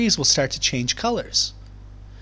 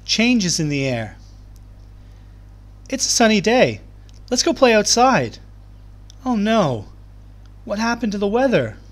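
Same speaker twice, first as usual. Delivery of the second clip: bright, lively read-aloud to a class, rising higher on the sunny day; reading to children; 6 semitones higher than usual. Acted. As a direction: read the opening pages like a storybook for young kids. Teacher reads picture book aloud.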